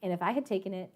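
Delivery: normal talking voice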